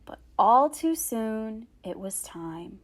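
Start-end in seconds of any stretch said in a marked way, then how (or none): 1.8-2.8 soft suspenseful whisper; hushed. A narrator's reading. Storyteller building suspense for children, whispering.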